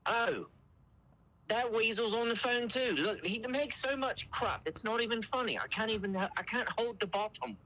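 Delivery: high, put-on British complainer; British accent. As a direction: fake aggrieved customer with a British accent. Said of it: "the best British accent I've ever heard".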